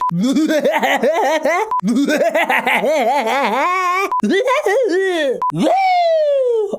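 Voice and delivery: long high laugh rising into a screech; exaggerated laugh. Shown as a demonstration. Voice actor overdoing a laugh take, high screeching laughter. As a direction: push a fake laugh until it screams. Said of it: "I probably clipped with that one".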